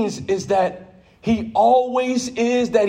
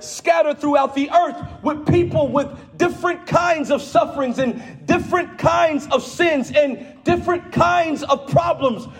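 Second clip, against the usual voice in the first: full-force preaching; 7 semitones higher than usual. Acted. Preacher to his church, calling out with full force. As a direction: preach it hard to the congregation.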